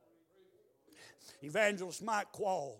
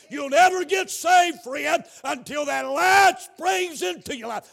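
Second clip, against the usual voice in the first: loud, raised revival preacher shout; full-force preaching; 5 semitones higher, 10 dB louder than usual. Real, not acted. Preacher urging someone in the congregation, full-force preaching. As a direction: drive it home at full volume.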